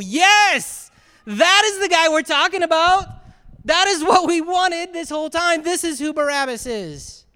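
loud, high cry of triumphant recognition; elated cheering. Acted. Preacher voicing a crowd who finally get their hero, elated. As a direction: shout it like a thrilled supporter.

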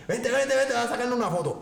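eager, laughing call to come over; a laughing, excited invite. Retold or imitated. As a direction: wave him in, thrilled and laughing. Eladio excitedly calling him over.

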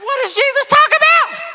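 high, loud, laughing disbelief; exasperated disbelief. Retold or imitated. Preacher voices the man's disbelief, very high. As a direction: throw up your hands.

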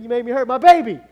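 loud, high, acted yelling; mad outburst. Father scolding the dog, comically furious. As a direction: roar the blame at the dog. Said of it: "And then I got mad".

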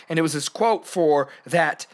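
ordinary talk, nothing marked

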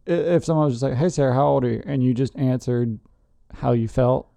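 ordinary talk, nothing marked

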